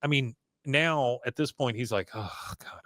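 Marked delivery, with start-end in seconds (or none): 2.1-2.8 very soft, whispered groan of resignation; mock dismay. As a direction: sigh it out under your breath. Hank's retold dismay, under his breath.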